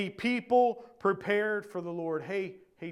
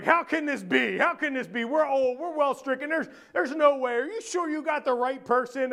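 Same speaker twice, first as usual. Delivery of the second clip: mildly hammed-up protest, higher voice; acted disbelief; 5 semitones higher than usual. Preacher acting Zechariah questioning the angel, incredulous. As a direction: plead in mock incredulity.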